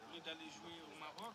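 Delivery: mic cutting out mid-sentence; faulty mic contact. Real, not acted. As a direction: voice dropping out on a bad cable. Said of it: "il y a un faux contact".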